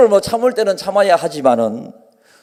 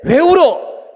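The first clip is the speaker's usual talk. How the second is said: mock irritated scold; 6 semitones higher, much slower than usual. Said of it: "이렇게 해가지고".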